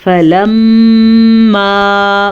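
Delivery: slow, drawn-out tajweed chant; Quran recitation. Shown as a demonstration. Teacher reciting for students. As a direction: intone the Arabic slowly.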